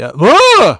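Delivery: loud, high yelp; sudden burst of a scream; startled yell. Real, not acted. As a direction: cry out in shock. Reacts to an unseen tweet.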